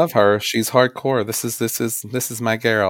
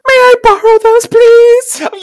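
very high, coy diva voice; Miss Piggy; 8 dB louder, slower than usual. Retold or imitated. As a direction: ask sweetly like a pampered star. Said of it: "and she goes".

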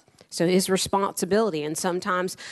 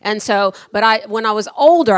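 normal talking voice

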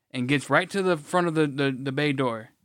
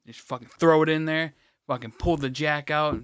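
usual speaking voice

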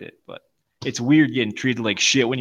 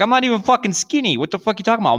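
high-pitched offended outburst; retold indignant protest; 9 semitones higher, faster than usual. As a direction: act out protesting the insult, voice shooting up. Retelling protest at being called skinny.

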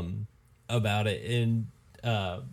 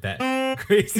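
laughing; 8 semitones higher, 5 dB louder, slower than usual. Real, not acted.

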